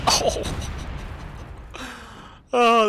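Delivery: ordinary talk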